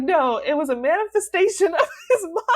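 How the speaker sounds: high, loud, laughing through the correction; laughing insistence. Retold or imitated. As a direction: insist while cracking up. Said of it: "I was like".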